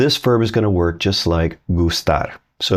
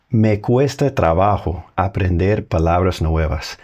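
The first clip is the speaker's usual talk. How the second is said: slow Spanish example. Shown as a demonstration. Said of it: "I could say".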